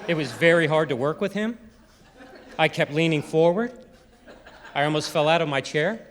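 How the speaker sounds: upturn on "him", "forward", "chair"; mimicked rising endings. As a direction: end each statement as if asking.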